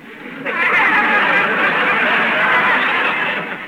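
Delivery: loud, high whooping laugh; big burst of laughter. Acted. [name] laughing hard at Betty's correction, loud and high. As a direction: break up laughing at the joke.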